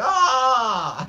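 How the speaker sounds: high, loud drawn-out "aah"; accidentally Irish-tinged cry; mock British "ah". Shown as a demonstration. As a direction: exclaim a long, high "ahh" in a botched British accent. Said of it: "needs to have a British accent".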